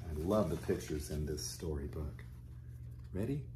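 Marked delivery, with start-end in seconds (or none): none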